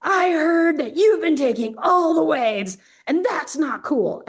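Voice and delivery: high, slow call-out; confronting. Retold or imitated. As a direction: lay into him, each word deliberate. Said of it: "she goes".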